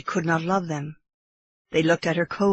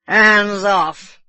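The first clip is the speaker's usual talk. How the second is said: put-on drunken bosun's cry; 3 semitones higher, 8 dB louder than usual. A narrator's reading. Bosun to Kiawe as he jumps back, loud drunken cry. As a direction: shout him off. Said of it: "cried the bosun".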